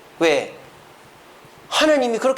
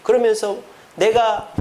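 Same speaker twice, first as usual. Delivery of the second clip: ordinary talk, nothing marked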